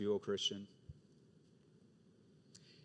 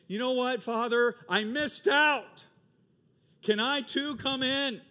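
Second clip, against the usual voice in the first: much higher, louder, slow plea; pleading confession; 10 semitones higher, 8 dB louder, slower than usual. Acted. A returning son to the Father, pleading slowly. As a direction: beg to be let in.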